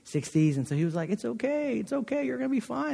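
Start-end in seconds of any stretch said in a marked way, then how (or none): 1.1-2.9 high, soft soothing reassurance; gently calm a scared friend down; older pastor soothing his nervous friend in the dark, softly reassuring. Retold or imitated. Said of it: "my friend's voice was so calm".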